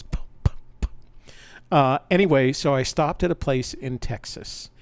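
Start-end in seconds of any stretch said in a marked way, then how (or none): 0.0-0.9 whispered puffs of a motorcycle engine running out of oil. Shown as a demonstration. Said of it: "these puffy sounds".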